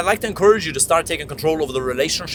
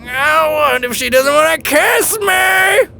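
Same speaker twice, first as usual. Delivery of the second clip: much higher and louder, slow cartoon squawk; silly duck voice; 11 semitones higher, 4 dB louder, much slower than usual. Shown as a demonstration. Same worry in a silly cartoon voice, much higher and louder. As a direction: make the worry sound ridiculous. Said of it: "instead you say".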